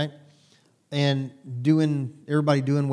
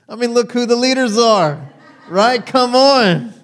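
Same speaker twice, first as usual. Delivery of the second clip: much higher and louder, fired up; excited; 8 semitones higher, 10 dB louder than usual. Real, not acted. Pastor rousing the congregation about the leaders, excited shout. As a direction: shout it out with glee.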